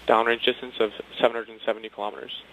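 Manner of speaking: ordinary talk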